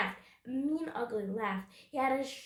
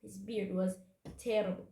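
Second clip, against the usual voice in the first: usual speaking voice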